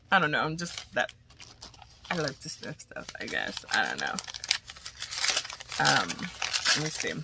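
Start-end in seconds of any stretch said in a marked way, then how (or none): none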